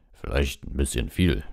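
slow, hesitant admission; unsure. A narrator's reading. Hagrid doubts his heavy scent, unsure. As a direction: admit it slowly, without confidence. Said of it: "sagte er unsicher".

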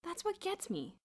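high-pitched frustrated exclamation; exclaimed. A narrator's reading. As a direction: burst out with it, voice jumping up. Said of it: "Boots exclaimed".

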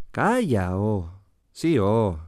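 "Calla, oh" and "Sí, oh" sliding down to a low ending; Asturian falling intonation. Shown as a demonstration. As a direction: let each "oh" drop away in the Asturian melody. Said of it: "característico descenso habitual que tenemos en la melodía". («Calla, oh», «Sí, oh» con descenso melódico asturiano)

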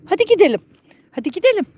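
high-pitched animal-coaxing call; urging along. Real, not acted. Owner urging the donkey to set off, high coaxing call.

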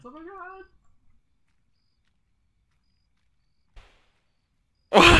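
piercing, full-volume shriek; high-pitched scream. Acted. Mock fan reaction to lawyer.

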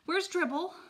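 loud, high panicked holler. Acted. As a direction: call out to mom in alarm. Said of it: "I hollered".

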